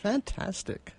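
ordinary delivery

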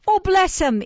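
high, loud gushing cry; gushing pity. A narrator's reading. Nurse gushing over Oliver, high and loud. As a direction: feign a rush of tenderness.

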